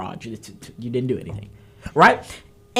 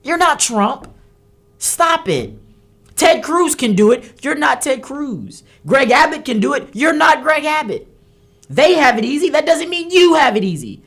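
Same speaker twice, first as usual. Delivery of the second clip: louder and higher, scolding hard; scolding candidates; 7 semitones higher, 3 dB louder than usual. Real, not acted. Host scolding weak Republican candidates, loud and fed up. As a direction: tell them off point by point. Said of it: "I'm mad".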